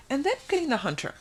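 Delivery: usual speaking voice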